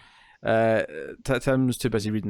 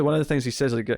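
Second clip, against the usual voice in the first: normal talking voice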